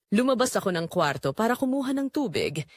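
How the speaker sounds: plain reading voice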